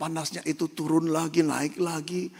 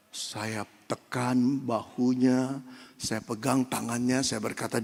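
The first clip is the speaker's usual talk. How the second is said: usual speaking voice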